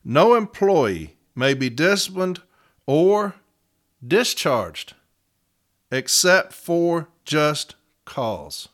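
"No employee" slow and forceful, pauses around "or" and "discharged"; emphatic reading. Retold or imitated. Advocate reading the contract to the arbitrator, slow and emphatic. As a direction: spell out "except for just cause" word by word, very slowly. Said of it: "put in words for emphasis".

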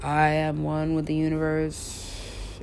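very slow, drawn-out trance-like drone; blissed-out drug trip. Acted. Imagining themselves on drugs they like, slow cosmic drone. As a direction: stretch every word as if floating in bliss. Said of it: "I'm just gonna be like".

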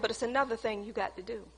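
soft, low and quick; hushed divine instruction; God's quiet voice. Retold or imitated. God telling her at home, soft and low.

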